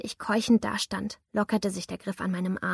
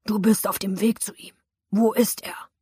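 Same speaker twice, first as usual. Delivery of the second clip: pressing threat; 4 dB louder than usual. A narrator's reading. Attacker presses, louder.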